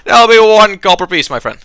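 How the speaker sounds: loud, high call for payment; showy innkeeper naming the bill; bartender voice. Acted. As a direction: announce the price heartily to a customer. Bartender states the price to customer.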